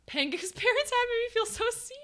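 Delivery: high voice breaking into laughter; laughing. Real, not acted. Streamer amused by talk of Pangu's parents, high laughing voice. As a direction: squeak it out through a laugh. Amused reading a chat message.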